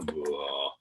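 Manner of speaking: very low, soft, slack blah; voice going down. Shown as a demonstration. Teacher showing loose vocal cords, low floppy blah. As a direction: drop the blah to the bottom of the voice. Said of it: "When you're going down".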